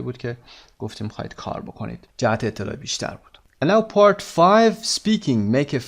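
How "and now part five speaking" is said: slow, drawn-out, raised announcement; section announcement. Acted. Teacher announces the next task to the class, drawn out. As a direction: call out the new part like a title.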